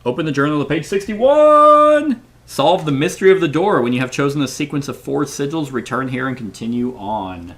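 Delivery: high, loud, stretched opening, then settling; theatrical game instruction. Acted. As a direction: proclaim the quest to the table.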